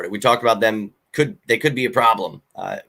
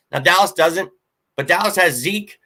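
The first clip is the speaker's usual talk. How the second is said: normal talking voice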